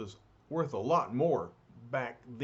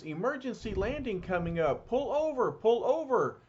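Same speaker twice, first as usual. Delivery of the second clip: calling out; 8 semitones higher than usual. Acted. Torch calling drivers off the road, high shout. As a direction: shout it over traffic noise.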